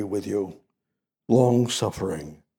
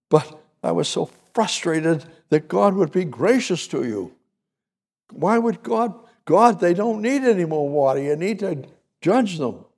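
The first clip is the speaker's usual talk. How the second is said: much higher, exasperated pleading to God; frustrated Moses; 7 semitones higher than usual. Acted. Moses pleads with God, higher and exasperated. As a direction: Moses venting that God is too gracious.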